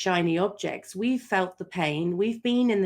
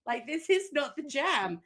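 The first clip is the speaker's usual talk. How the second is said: very slow, higher mock plea; mid-labor plea; 6 semitones higher, slower than usual. Mother in childbirth, slow mock plea. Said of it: "you're like".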